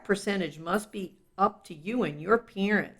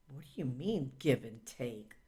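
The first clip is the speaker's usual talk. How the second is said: softer, lower, lightly voiced puzzled kid; a kid who doesn't get it yet; puzzled child; 4 semitones lower, 14 dB quieter than usual. Child asking the narrator about give and take, softly puzzled.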